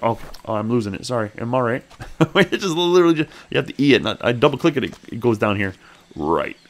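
ordinary delivery

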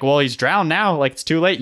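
mock-exasperated examiner; imitated instructor rebuke. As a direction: lightly act an examiner laying down the verdict. Acted instructors scolding failed students.

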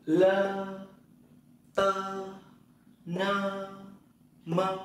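"Lơ, tơ, nơ, mơ" each held on a high level tone; long, separate syllables from "Lơ" to "mơ"; syllable drill. Shown as a demonstration. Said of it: "Thử nó này". (đọc "Lơ, tơ, nơ, mơ" ngân dài, cao đều)

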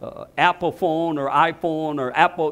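normal talking voice

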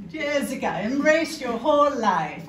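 big, slow mock-Catalan accent gone German; botched Catalan accent, German-sounding. Shown as a demonstration. Comedian retrying mother-in-law's advice, botched Catalan accent. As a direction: stretch it out loudly in a badly faked Catalan accent. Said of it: "It's German".

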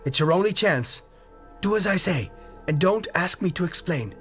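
high frantic urgent pleading; desperately urging escape; wild consternation. A narrator's reading. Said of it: "in a pitch of wilder consternation".

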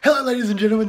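sudden loud high greeting to jump-scare; startling boo. Acted. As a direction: burst in loud to startle the viewers. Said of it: "Did I scare ya?".

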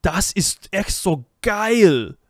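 loud, slow, delighted German exclamation; beaming German praise; excited German teacher. Retold or imitated. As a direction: gush it out like a thrilled teacher. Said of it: "And he goes".

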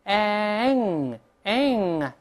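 ang stretched from front "eh" into ng; spread, then blocked. Shown as a demonstration. Teacher demonstrates slowly, ang as long high "aeng". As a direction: hold ang long and high, tongue root closing the throat. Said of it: "先咧嘴，再拱上舌根，把喉咙堵住".

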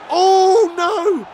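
high, loud cry of dismay; shocked shout. Real, not acted. Streamer watching his match slip, shocked shout. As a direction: yell it out as the chance goes begging. Reacting to a goal chance.

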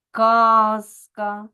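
"казка" with a distinct З, never С; clearly voiced consonant. Shown as a demonstration. Teacher shows voiced sounds staying clear, clearly voiced consonant. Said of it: "а вимовляються чітко".

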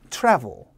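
"travel" with the first vowel wide, lips pulled sideways; spread-mouth vowel. Shown as a demonstration. Teacher modelling for the class, "travel" with a wide-spread vowel. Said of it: "입을 양쪽으로 당겨서".

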